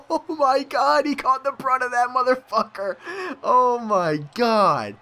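high, excited outcry; astonished exclaiming. Real, not acted. Player marvelling at who caught the brunt, astonished. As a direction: keep yelling in disbelief, easing down slowly.

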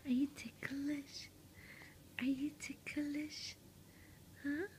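hushed teasing question, a bit slow; teasing whisper. Real, not acted. Mother teasing her baby in a hushed whisper. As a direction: whisper it like you're about to tickle.

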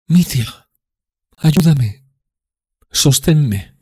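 high, loud tipsy woman pleading; drunk Grushenka. Acted. Grushenka begging for support.

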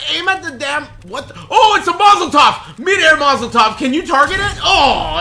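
very high, very loud panicked yells; frantic shouting. Real, not acted. Gamer shouting to a teammate during chaos. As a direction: scream it out mid-fight. Chaotic fight, yelling at teammate.

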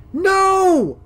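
long, high, loud cry; desperate drawn-out shout; horrified scream. Acted. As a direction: scream out to stop it. Girl screams as trigger is pulled.

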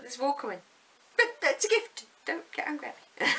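high, loud pleading; pleading to husband. Retold or imitated. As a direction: beg him not to be cross, up high. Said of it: "I'm like".

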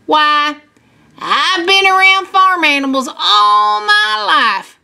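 put-on lilting old-hand farmer; folksy farmer. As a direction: tell kids about a life with animals. Farmer talks about his life.

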